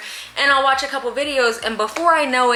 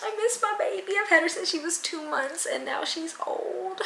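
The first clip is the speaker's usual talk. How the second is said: higher, softer, nearly whispered longing; missing her cat; 9 semitones higher, 7 dB quieter than usual. Real, not acted. To viewers about her faraway cat, tender and sad. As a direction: let the ache for her cat come through softly.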